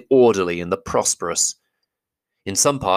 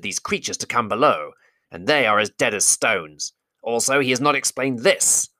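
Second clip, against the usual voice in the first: goblin driver voice; 4 semitones higher than usual. Acted. Driver accuses Thorin.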